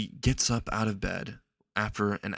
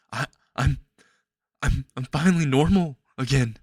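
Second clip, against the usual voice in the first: stammering, overwhelmed with relief; half laughing, half crying with joy; joyful disbelief; 4 semitones higher, 4 dB louder than usual. Acted. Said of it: "he just starts laughing".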